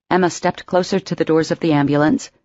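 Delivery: ordinary delivery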